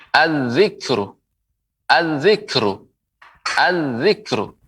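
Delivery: "az-zikru" slowly with doubled z, no l; slow repeated model of "az-zikru", lam silent; lam merged into zal. Shown as a demonstration. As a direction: hold the first consonant of "zikru" in "az-zikru". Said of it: "melebur ke huruf zal".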